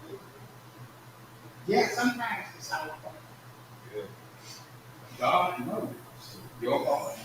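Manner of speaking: ordinary talk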